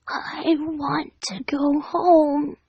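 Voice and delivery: slow, whispered sob; sobbing whisper. Acted. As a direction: breathe it out broken by tears. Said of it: "Dash sobbed".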